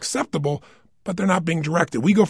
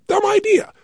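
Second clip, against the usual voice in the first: high, scoffing "dumb idea"; mocking skeptic; 3 dB louder than usual. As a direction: scoff at it like a doubter. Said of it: "You go".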